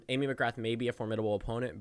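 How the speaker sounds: usual speaking voice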